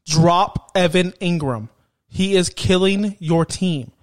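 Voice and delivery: slow, deliberate, every word weighted; slow emphatic verdict; said with my chest. Shown as a demonstration. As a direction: lay it down firmly and slowly. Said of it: "I'm gonna say it with my chest".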